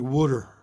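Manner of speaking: "wooder" with the vowel of wood; Philly pronunciation. Shown as a demonstration. Showing how Philadelphians say water, "wooder" with a wood vowel. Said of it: "we say things like".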